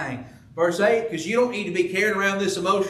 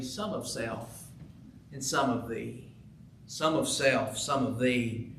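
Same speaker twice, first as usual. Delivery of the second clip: faintly; 4 semitones lower, 7 dB quieter than usual. Acted. The wistful heart answering faintly, much softer. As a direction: barely breathe the words. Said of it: "my wistful heart said faintly".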